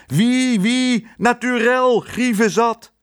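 high, loud, stretched-out call; slow, high-pitched hearty shout; calling to guests. A narrator's reading. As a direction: sing out to guests across the terrace. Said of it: "hij riep naar de gasten".

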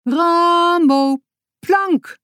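high, loud call to the goat; roepend bevel. A narrator's reading. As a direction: shout the command across the yard. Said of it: "riep Boutje". (hoog en luid roepen)

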